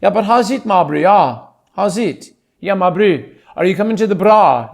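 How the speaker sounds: easygoing South African lilt; South African accent. Shown as a demonstration. Showing off the accent's stock greetings, South African accent. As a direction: speak as a friendly South African. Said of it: "just coming back to South African".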